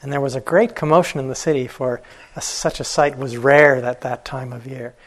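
ordinary delivery, nothing marked

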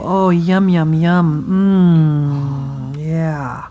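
savouring, drawn-out murmur; contented body voice. Acted. Teacher voicing places in the body, savouring and drawn out. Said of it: "places in the body that go".

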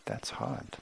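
soft, tender soothing to a hurting friend; gently comfort, hushed and caring. Retold or imitated. Teacher imitating her own tender comfort to someone in pain. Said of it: "I hear myself saying".

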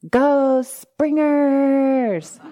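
high, loud, drawn-out cheer from afar; cheering shout. Retold or imitated. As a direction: call it out slowly like friends cheering from the crowd. Friends cheering her on.

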